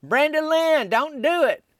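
high desperate holler from down the street. Retold or imitated. Husband chasing the car hollers at his wife, high and loud. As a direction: yell after her, begging her to stop. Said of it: "he's hollering".

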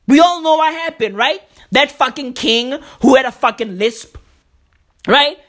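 ordinary talk

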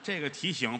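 ordinary delivery

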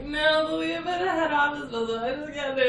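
high, loud protest to the supervisor; upset protest. Retold or imitated. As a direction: insist you can't face it, voice up.

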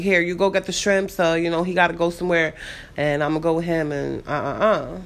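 ordinary delivery

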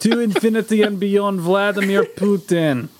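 hammed-up Russian Buzz Lightyear; Russian accent. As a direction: proclaim it in a put-on Russian accent. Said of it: "So I'm a Russian".

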